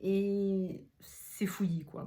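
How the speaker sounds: slow, whispered self-mocking aside. Real, not acted. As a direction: whisper it to yourself, amused. Self-mocking aside about digressing.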